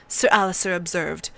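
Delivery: usual speaking voice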